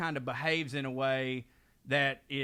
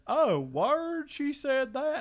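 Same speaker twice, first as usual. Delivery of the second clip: much higher, wide-eyed Wade; mock surprise; 11 semitones higher, 4 dB louder, much slower than usual. Wade hearing the complaint, in a mock surprised high voice. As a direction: act innocently amazed she'd say that. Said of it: "he goes".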